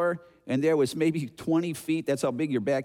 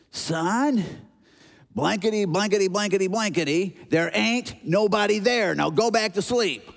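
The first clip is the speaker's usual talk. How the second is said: exasperated swearing father; 5 semitones higher than usual. Acted. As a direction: act a fed-up dad laying down the law. Swearing dad, censored, acted.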